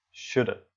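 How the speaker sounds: "shoulda" with the "have" shrunk to "uh"; casual reduced "shoulda"; further reduced form. Shown as a demonstration. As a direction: say "shoulda" as one linked word, no v. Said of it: "Ou até shoulda".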